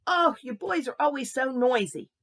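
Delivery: put-on loud, high, cranky old lady; cranky scolding. Cranky neighbour to noisy boys, loud scolding. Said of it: "Miss Baker's always a little bit cranky".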